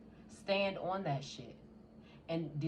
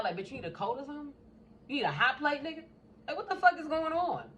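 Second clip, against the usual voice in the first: higher, louder sarcastic questions; mocking incredulity; 8 semitones higher, 4 dB louder, faster than usual. To Libra's clingy suitor, loud high sarcastic mockery. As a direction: sneer at someone rushing into things.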